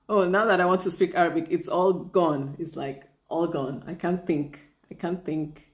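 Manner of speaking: usual speaking voice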